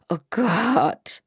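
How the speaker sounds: groaned-out exclamation; exasperated groan. A narrator's reading. Melanie moans about her relatives at home, groaning. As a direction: groan it like an eye-roll. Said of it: "Melanie stöhnte und verdrehte die Augen".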